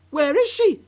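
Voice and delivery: high, loud frightened cry. Acted. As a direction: cry out, searching in panic. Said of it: "cried Missus Cranky".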